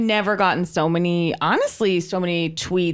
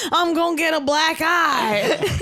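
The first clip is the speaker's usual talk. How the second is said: high, slow whiny complaint through laughter; mock whine; 6 semitones higher, slower than usual. Woman in passenger seat whining, laughing. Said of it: "And she goes".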